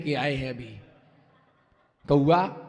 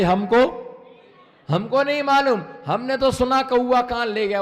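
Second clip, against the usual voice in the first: higher, louder defensive denial; flustered villager; 6 semitones higher, 5 dB louder than usual. Retold or imitated. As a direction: shrug off the blame loudly. Villager denies, blames rumour.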